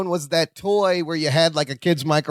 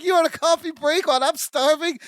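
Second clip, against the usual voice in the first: higher indignant complaint; half-laughing at the nerve; mock outrage; 9 semitones higher than usual. Starving passenger shouting at Balky, mock outrage.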